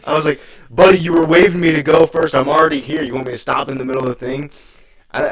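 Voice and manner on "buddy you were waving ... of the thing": loud mock New York accent. Driver arguing back at the cop in New York accent. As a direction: argue like a New Yorker. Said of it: "I had, like, a New York accent".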